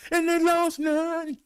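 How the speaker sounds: high, drawn-out exclamation; slow, excited high-pitched call; celebratory cry. Real, not acted. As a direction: ring it out high and jubilant. Celebrating no deaths reported.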